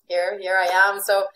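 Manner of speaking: ordinary talk, nothing marked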